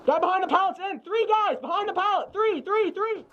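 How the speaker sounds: high urgent yelling to teammates; shouted callout. Real, not acted.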